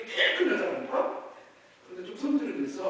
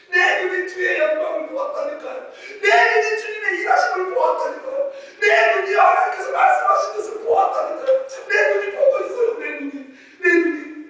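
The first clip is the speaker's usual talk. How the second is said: exultant testimony; 9 semitones higher, 13 dB louder than usual. Real, not acted.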